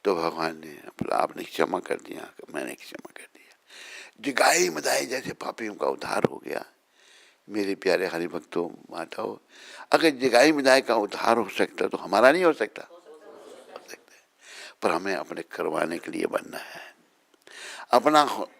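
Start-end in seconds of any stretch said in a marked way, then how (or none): 2.5-3.5 whispered, much lower and softer; hushed forgiveness. Acted. The Lord forgiving the sinners, soft whisper. 12.8-14.3 whispered answer. Acted. Answering his own question softly.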